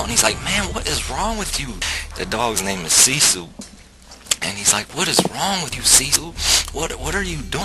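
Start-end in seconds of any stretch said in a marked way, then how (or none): 0.4-1.7 high-pitched mock alarm at the dog; imitated freak-out. Friend scolding his dog as it chews him, freaking out. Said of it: "my friend starts freaking out". 5.0-7.6 high, exasperated questioning of a pet; imitated freak-out. Friend questioning his misbehaving dog Sisu, freaking out. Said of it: "my friend starts freaking out".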